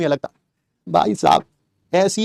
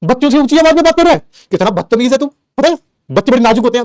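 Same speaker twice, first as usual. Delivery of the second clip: imitating scolding father; 6 semitones higher, 4 dB louder than usual. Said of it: "अब वो मुझे कहते हैं".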